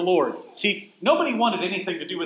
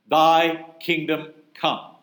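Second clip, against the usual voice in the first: slow prayer quote; 3 dB louder, much slower than usual. Shown as a demonstration. Teacher quotes the prayer slowly.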